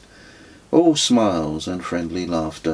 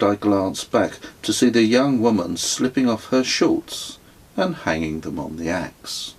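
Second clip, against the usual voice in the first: ordinary delivery, nothing marked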